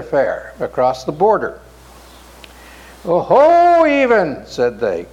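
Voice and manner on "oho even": put-on high, loud cheery hail to an old friend; glad greeting. A narrator's reading. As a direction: call out delighted to see him. Merchants greet Even gladly.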